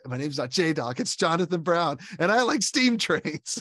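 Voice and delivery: building to a high, loud declaration; defiant Jonathan Brown. Retold or imitated. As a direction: correct the nickname, then proclaim it slowly. Jonathan corrects nickname, declares himself.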